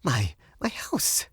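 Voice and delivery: slow, whispered, faltering question; hushed, halting disbelief; taken aback. A narrator's reading. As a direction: stammer it in a near whisper. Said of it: "she repeated".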